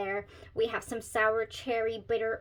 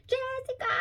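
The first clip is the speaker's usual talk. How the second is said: a much higher squawked name call; yelling chicken. Retold or imitated. Reviewer imitating the name-yelling chicken meme, high squawk. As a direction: shriek the name like the viral chicken. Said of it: "I sounded like that chicken that n-, yells the names".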